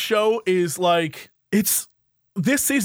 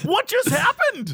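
baffled; 9 semitones higher, slower than usual. Retold or imitated. Viewer baffled by Olivia.

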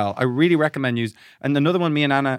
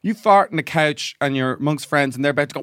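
ordinary talk

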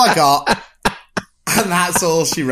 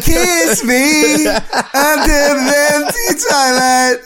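slow, higher, hammed-up swoony movie line through a laugh; mock romantic line; 8 semitones higher, much slower than usual. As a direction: ham it up like a cheesy film kiss.

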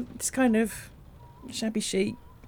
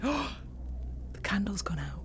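slow frightened whisper; 7 dB quieter, much slower than usual. Acted. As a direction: breathe it out in fear. Candle dies during séance.

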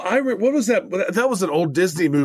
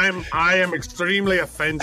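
mock-offended put-on Irish accent; bad Irish accent; 3 semitones higher than usual. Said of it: "This is the Bad Irish Accent Podcast".

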